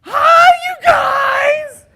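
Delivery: shrieked, very high and loud hello; excited greeting. Retold or imitated. A passionate greeting the seminar group, shrieking with excitement. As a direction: burst in squealing with delight. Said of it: "she goes".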